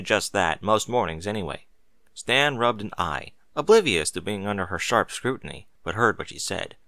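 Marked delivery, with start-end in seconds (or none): none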